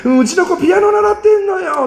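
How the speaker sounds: high, showy mock mother's boast; bragging mom. Mocking a mother who boasts about piano lessons. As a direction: ham up a proud snobbish mom.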